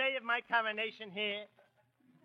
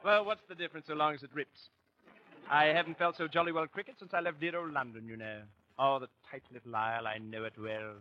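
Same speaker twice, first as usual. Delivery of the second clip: put-on lightly done, quick English gentleman accent; mock English accent; 7 semitones lower than usual. [name] reminisces about London, light mock English accent. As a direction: rattle off the English place names in an English accent. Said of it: "What's wrong with my English accent?".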